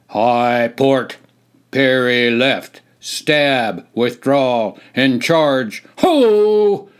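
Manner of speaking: loud, clipped drill-sergeant bark; barked commands. Acted. Sergeant to the recruits, barking commands. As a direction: bark orders across the field. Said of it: "barked the sergeant".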